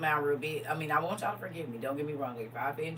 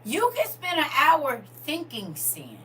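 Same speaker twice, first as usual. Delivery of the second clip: preaching; 9 semitones higher, 9 dB louder than usual. Acted. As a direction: drive it home to the congregation. Preaching at full force.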